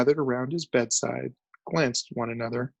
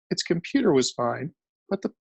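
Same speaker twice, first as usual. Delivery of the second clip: usual speaking voice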